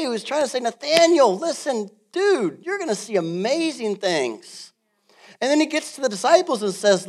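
loud, high impassioned preaching; fired-up preaching. Acted. Preacher urging parents to equip kids: loud, high, impassioned. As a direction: lift the room with it.

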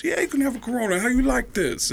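high put-on celebrity voice; Tracy Morgan impression. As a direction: call someone over in high mimicry. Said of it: "And he goes".

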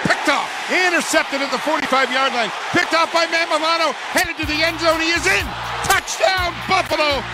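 high, excited play-by-play call; soaring radio touchdown call, voice climbing. Real, not acted.

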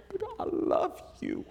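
breathy, slow, intimate whisper; tender whisper. Retold or imitated. Wife whispering love to her husband Peter, softly.

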